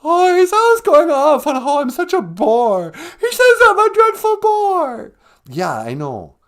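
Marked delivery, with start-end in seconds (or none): 0.0-5.1 much higher, louder, gushing caricature of Megan; mock Megan voice. Host plays Megan on the phone, high gushing squeal. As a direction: squeal it like an overexcited fan. Megan voice complains about husband.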